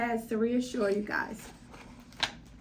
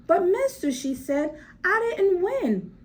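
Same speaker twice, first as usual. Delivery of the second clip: put-on 1 protesting little girl; 7 semitones higher, 4 dB louder than usual. Child protests to manager.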